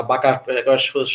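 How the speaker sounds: usual speaking voice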